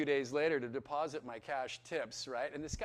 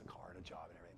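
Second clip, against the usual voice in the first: normal talking voice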